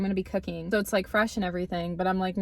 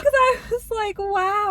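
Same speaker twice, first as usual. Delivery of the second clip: high-pitched exclaim; 11 semitones higher, 5 dB louder, slower than usual. Retold or imitated. Recalling feeling threatened.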